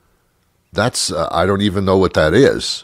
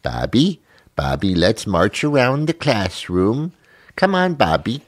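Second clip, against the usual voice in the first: mock preschool teacher rounding up Bobby; hammed-up kindergarten teacher voice, slowed down; 4 semitones higher than usual. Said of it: "makes me feel like I'm in kindergarten".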